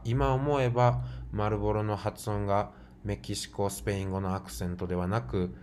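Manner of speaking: ordinary talk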